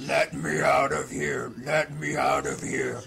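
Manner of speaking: loud, drawn-out villain cry to be let out; trapped demon voice. Acted. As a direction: shout it like a sealed-away demon. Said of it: "you hear this voice that says".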